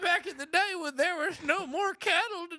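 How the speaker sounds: put-on high, tired old-timer voice; exhausted old guy. Working man recalling cattle days, high weary old-guy voice. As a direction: reminisce as a worn-out comic cowhand. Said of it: "yours sounds like he's, like, very exhausted".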